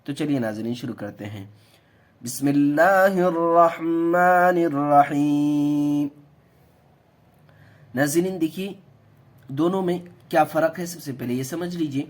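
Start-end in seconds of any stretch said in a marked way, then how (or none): 2.2-6.1 slow, loud, drawn-out Arabic recitation; solemn recitation. Acted. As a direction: intone it reverently and slowly. Formal invocation before lesson.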